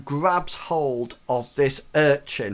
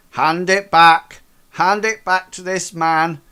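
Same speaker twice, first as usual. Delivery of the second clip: guard's order; 3 semitones higher, 7 dB louder than usual. Acted.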